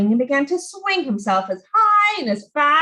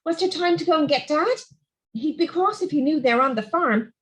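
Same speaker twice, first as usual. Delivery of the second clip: ordinary delivery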